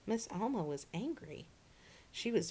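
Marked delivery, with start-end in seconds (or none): none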